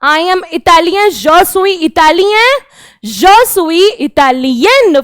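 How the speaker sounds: je suis Italien spoken very slowly, nasal ending; slow French demo. Shown as a demonstration. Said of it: "मेल बोलेगा".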